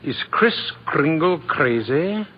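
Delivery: measured, savoring read-out; reading headlines aloud. Acted. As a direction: spell out each headline word by word.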